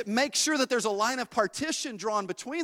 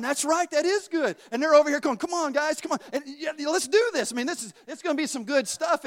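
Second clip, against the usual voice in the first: higher, louder, coaxing; eager tempters; 6 semitones higher, 3 dB louder than usual. Acted. The world and flesh urging him to drink, eager. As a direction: egg him on like a bad crowd.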